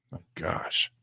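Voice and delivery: breathless whisper of disbelief. Real, not acted. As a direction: whisper it under your breath. Watching the trade move.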